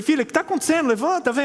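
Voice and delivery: put-on worried dad coaxing his little girl; urging daughter up. Father urging fallen daughter.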